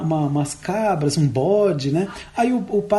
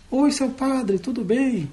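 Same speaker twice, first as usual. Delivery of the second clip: a lightly voiced sweet little mule, a bit higher; the little mule's voice; 6 semitones higher, slower than usual. Priest voicing the mule to fool the boy, lightly higher. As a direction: priest throws his voice as the mule. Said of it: "E aí fez a voz da Mulinha".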